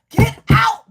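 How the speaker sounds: high, loud command; hollered warning to leave; urgent shout. Real, not acted. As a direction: punch both words hard. Urging viewer to flee relationship.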